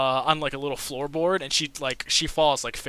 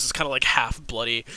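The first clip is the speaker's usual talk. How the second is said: normal talking voice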